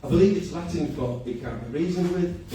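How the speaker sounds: ordinary talk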